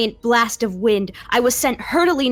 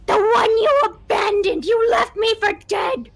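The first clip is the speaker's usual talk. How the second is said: accusing shriek; 12 semitones higher, 4 dB louder than usual. Acted. Ghost accusing.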